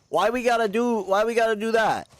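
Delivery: high, whiny mock indignation; mock hurt. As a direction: play the wounded friend. Teammate attacked him playfully.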